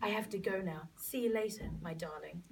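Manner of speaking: soft hushed goodbye. Acted. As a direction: whisper a tender farewell.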